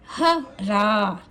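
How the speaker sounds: ह रा very slowly, आ in रा stretched; long vowel drawn out. Shown as a demonstration. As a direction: hold the second syllable's आ long. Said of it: "उसको थोड़ा देर तक बोलेंगे".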